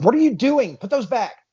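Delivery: high, loud, acted outburst; alarmed scolding. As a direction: call out in alarm to stop someone. Guy alarmed at items being touched.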